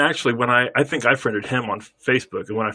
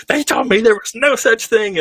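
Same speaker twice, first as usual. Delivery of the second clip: high, loud indignant whine; imitating her furious complaint; mock angry complaint; 11 semitones higher, 5 dB louder than usual. Sister fuming at the trick, mock indignant.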